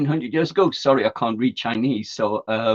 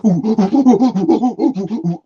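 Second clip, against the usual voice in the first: monkey hoots; 8 semitones higher, 8 dB louder than usual. Acted. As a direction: hoot like a monkey. Monkey joke.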